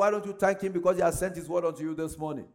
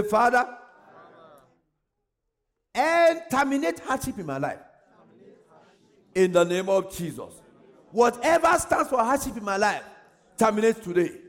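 louder, pressing prayer declaration; fervent prayer; 3 dB louder than usual. Acted. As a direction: declare it over your life. Leads congregation in declaring prayer.

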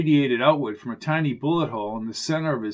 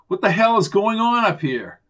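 louder, higher-pitched demand; raised voice demanding an explanation; alarmed shout; 6 semitones higher, 5 dB louder than usual. Acted. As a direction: call out in alarm. Runs toward noise, demands answer.

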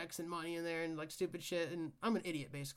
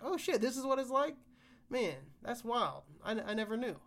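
mock amazement; 6 semitones higher, 5 dB louder than usual. Imagining a crazy baby mama call.

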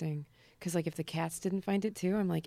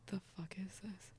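whispered; 15 dB quieter than usual. Retold or imitated. Retold shocked reaction.

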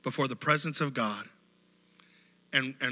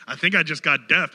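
louder, half-shouted over the music; mock loud concert exclamation; 8 dB louder than usual. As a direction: joke as if ears are ringing. Acted concert-goer exclaiming.

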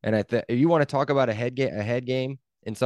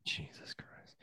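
under-the-breath whispered curse; 22 dB quieter, much slower than usual. Real, not acted. Co-host, dismayed at the missed kick, whispered curse.

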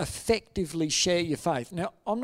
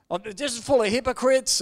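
retold higher-pitched complaint; mild mock rant, pitched up; letting rip; 7 semitones higher, 3 dB louder than usual. Angry visitor complaining about churches, retold as a heated rant. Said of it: "really let rip".

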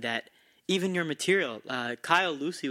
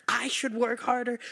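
mocking the manager; 9 semitones higher than usual. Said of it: "he goes".

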